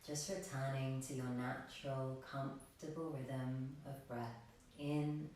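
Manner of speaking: soft, unhurried meditation voice with long pauses; guided meditation. Real, not acted. Yoga teacher guiding extended exhalations, soft meditation voice. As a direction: lead them quietly toward stillness.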